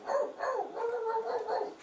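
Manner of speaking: a highway dog yapping nonstop; imitated dog barking. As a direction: bark like an annoying little dog. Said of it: "his dog just".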